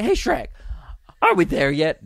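high, eager sidekick calling out; high-pitched acted nagging; pestering movie sidekick. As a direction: pester like an impatient travel companion. Acting Donkey pestering Shrek.

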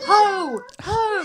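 high-pitched calling cry; imitated toddler scream. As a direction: mimic a toddler yelling out in the night. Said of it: "she screams out".

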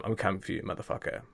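hushed, breathy threat with no voice on the last word; menacing whisper. Retold or imitated. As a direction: drop to a whisper and make it a promise. Threatening comment.

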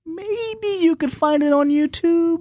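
high, drawn-out playful suggestion; coy hint. Pretend. Host slyly hinting to viewers where to find the film, coy. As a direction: float it up high and slow, as if innocently.

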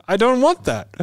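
high, loud exaggerated brush-off; mock blunt refusal. Telling a friend how he turned down the salesman, mock blunt. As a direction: toss it back like a cartoon of himself. Retelling his flat refusal.

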